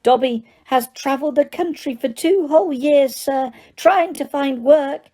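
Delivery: high, squeaky elf voice. Acted. Dobby explains his search for work to Harry, squeaking. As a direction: squeak it like a tiny house elf. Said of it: "Dobby squeaked".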